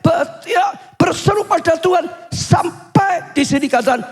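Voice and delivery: high, emphatic sermon delivery; impassioned preaching. Acted. Preacher tells congregation their cries reach God's heart, impassioned preaching. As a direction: drive each repeated phrase home with rising fervor.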